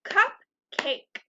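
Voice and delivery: Cupcake clapped out as cup, cake, two beats; clapped syllables. Shown as a demonstration. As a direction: clap each syllable of Cupcake. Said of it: "Let's clap out the word cupcake".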